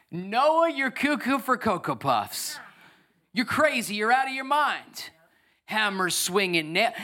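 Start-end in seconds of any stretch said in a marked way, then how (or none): 0.1-5.1 mocking neighbour.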